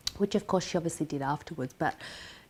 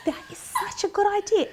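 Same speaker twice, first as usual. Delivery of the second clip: mock oblivious enthusiasm; 14 semitones higher than usual. Patient mocking how she welcomed the plan, oblivious enthusiasm. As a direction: exclaim it with silly delight.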